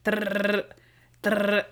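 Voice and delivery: rolled trilling drill noise; short bursts of mimicked drilling; imitated jackhammer. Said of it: "When it's like".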